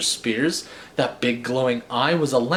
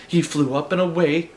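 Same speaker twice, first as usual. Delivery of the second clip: plain reading voice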